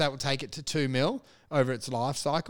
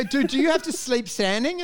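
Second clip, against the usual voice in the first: higher, incredulous mate; mate asking the comic in the post box; mates teasing; 8 semitones higher than usual. Retold or imitated. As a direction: ask it like a baffled friend. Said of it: "they go".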